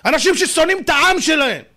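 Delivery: high, loud outraged preaching; outraged shout. Real, not acted. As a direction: thunder it out in disgust. (זעקה נרגשת)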